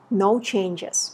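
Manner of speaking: ordinary talk, nothing marked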